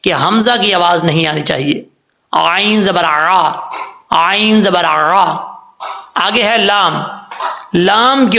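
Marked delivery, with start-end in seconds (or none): 2.3-5.3 slow, drawn-out Quranic recitation; slow recitation demo. Shown as a demonstration. Teacher reciting slowly for students to copy. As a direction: chant each letter slowly for the students. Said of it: "Quran teacher demonstrating recitation".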